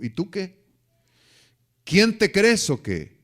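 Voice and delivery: loud, high, slow scolding father; angry father. Retold or imitated. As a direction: bark it down at the boy. Father scolds boy at church. (padre regañando con enojo)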